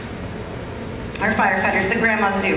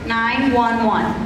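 slow and clear; much slower than usual. Shown as a demonstration. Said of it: "So one nine and two ones".

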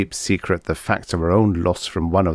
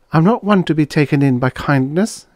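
high-pitched, cool rebuff; cold; 8 semitones higher, 3 dB louder than usual. A narrator's reading. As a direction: voice her high and coldly unmoved. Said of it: "she said coldly".

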